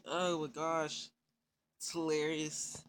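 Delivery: ordinary talk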